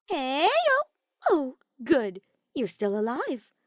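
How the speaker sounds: Kokichi, high, loud and ominously chirpy; sing-song menace, bright on top; ominously cheerful. A narrator's reading. Kokichi greets a hiding hostage, ominously cheerful. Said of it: "its ominously cheerful tone".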